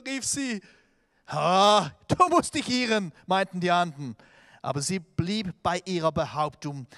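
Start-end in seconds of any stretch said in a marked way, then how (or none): 1.3-3.1 loud disbelief. Acted. Disciples doubt Rhoda's news.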